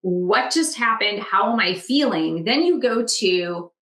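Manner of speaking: ordinary delivery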